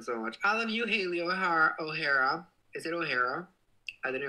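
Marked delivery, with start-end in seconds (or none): none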